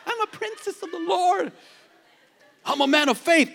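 high, prissy protest; entitled princess. Pretend. Pastor mocking a believer claiming special status, high and prissy. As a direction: play the pampered princess of the Lord.

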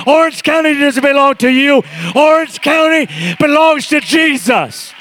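rally the church into a full shouting climax; building shout. Retold or imitated. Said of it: "she was so eloquent".

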